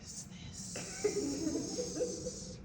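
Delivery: high, slow, soft whisper; mock suspicious whisper. Marie asking who is on the call, soft suspicious whisper. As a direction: hush it suspiciously. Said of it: "And Marie goes".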